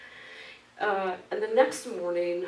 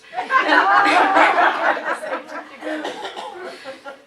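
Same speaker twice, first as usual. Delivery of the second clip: long loud laughter; 12 semitones higher, 14 dB louder than usual. Real, not acted. Long laugh after punchline.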